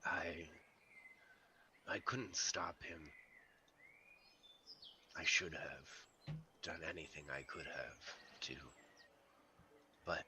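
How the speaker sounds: quiet, grieving wolf voice; a hint of a sorrowful wolf. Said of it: "in a wolf voice".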